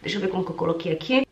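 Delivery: normal talking voice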